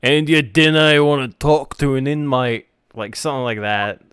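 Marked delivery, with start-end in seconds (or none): 0.0-2.7 louder Scottish demo; Scottish accent. Shown as a demonstration. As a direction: demonstrate the line in broad Scottish. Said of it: "Scottish is weird".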